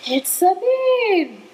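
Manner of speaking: high, long drawn-out squeal; starstruck fans. Retold or imitated. Troop onlookers spotting Sabine, thrilled. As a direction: imitate a crowd's thrilled recognition. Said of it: "everybody's like".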